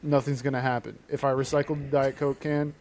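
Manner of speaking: ordinary talk, nothing marked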